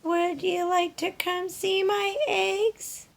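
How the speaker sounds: high, slow hen voice, a touch weary; a little hen asking one last time; high, slow, slightly weary hen voice; Dora the hen, hopeful but sad. Acted. Dora asks, saddening.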